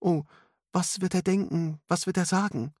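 put-on very high, anxious girlish voice; lightly acted fright, pitch well up; stammering fear. A narrator's reading. Alice shows her fear of Arthur's judgement, high and anxious. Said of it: "stammelte das Mädchen".

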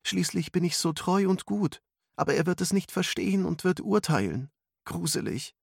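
put-on high-pitched fretful girl's voice; anxious girl. A narrator's reading. Alice frets that Arthur will judge her, high anxious voice. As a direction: voice her worry high and girlish.

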